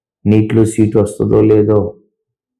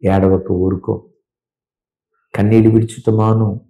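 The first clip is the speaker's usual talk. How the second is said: gentle voice of God. Acted. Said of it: "ప్రభు అంటాడు".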